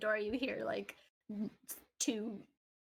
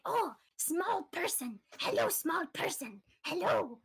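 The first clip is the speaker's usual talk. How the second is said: high, slow goblin greeting; 8 semitones higher, slower than usual. Acted. Goblin greeting the small boy in a high slow voice. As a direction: croon it like a delighted goblin.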